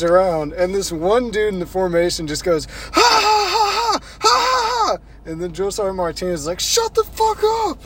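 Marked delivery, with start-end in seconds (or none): 2.7-5.0 very high, loud mock guffaw; big fake laugh, one long burst after another; imitated forced laugh. Imitates recruit's forced laugh at road. 6.6-7.8 very high imitated sergeant yell; drill sergeant shout. Drill sergeant silences laughing recruit.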